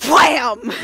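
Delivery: sudden booming "wham"; loud impact. Acted. Game master narrating a sudden crash, loud impact. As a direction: slam the word out loud.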